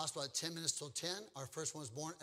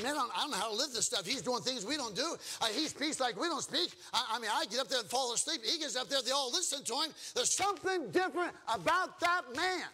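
puzzled man marvelling, pitched up; lightly voiced Nicodemus; 7 semitones higher, 5 dB louder than usual. Nicodemus puzzles over how differently Jesus lives, building astonishment. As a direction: build to a high, loud conclusion. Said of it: "And now Nicodemus said".

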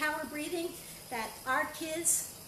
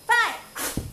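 breathy blown count; forceful breath out; 8 semitones higher, 10 dB louder than usual. Shown as a demonstration. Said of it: "There, breathing out".

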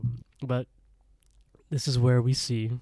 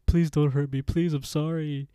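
higher begging voice; pleading, mock frightened; 5 semitones higher than usual. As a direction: act out a cowering plea. Captain begs while backing away.

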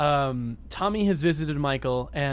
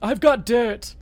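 posh rich guy; 7 semitones higher, 4 dB louder than usual. Pretend. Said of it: "character we've invented".

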